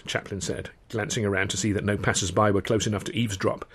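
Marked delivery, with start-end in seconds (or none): none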